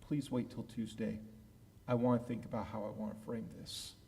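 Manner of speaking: a lightly voiced boss keeping it close; calm boss. Senior rabbi putting off his nervous assistant, soft and measured.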